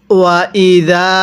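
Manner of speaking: slow drawn ওয়া ইদা with the alif stretched; Quranic recitation. Shown as a demonstration. Teacher recites to students, slow and drawn. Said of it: "কিভাবে পড়তে হবে".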